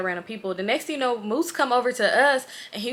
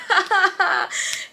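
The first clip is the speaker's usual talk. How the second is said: high-pitched mock laughing fit; imitated laugh; 10 semitones higher, 3 dB louder than usual. Storyteller shows how she laughed while being tickled, mock laughing. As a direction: act out helpless laughter. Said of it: "but I'm laughing like".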